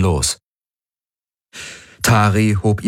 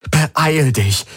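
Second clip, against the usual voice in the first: pleading; 8 semitones higher than usual. Pretend. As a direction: hurry him with a higher, begging plea. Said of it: "flehte er". (flehend, etwas höher)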